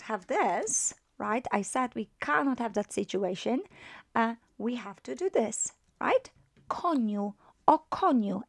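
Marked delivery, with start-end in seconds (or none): none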